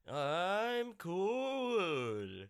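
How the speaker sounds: drawn-out self-satisfied Shatner take; Shatner impression. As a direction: stretch it out, rising and falling. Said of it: "Shatner was like".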